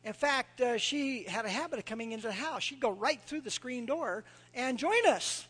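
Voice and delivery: ordinary delivery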